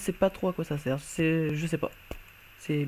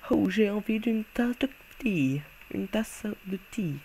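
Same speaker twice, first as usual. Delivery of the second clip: put-on English accent; 4 semitones higher, 4 dB quieter than usual. Wearing a monocle, he puts on a mock English accent. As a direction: play a posh Englishman craving tea with an English accent. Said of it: "l'accent anglais".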